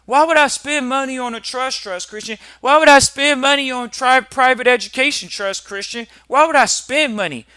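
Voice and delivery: high, loud, indignant whining; mock stingy client. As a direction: protest like a client who won't pay.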